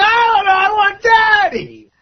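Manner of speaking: mock toddler bawling for a parent; whining kid. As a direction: imitate a tearful four-year-old. Imitating the kid crying for father.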